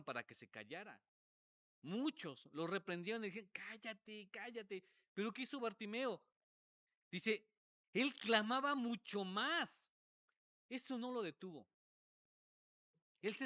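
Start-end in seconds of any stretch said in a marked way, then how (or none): 3.5-4.8 higher, softened imitated rebuke; hushed put-on scolding; mock shushing. Crowd rebuking Bartimeo to silence. 7.9-9.9 loud, high, slow proclamation; slow, swelling high shout; emphatic loud. Retold or imitated. Showing the louder clamour by voice.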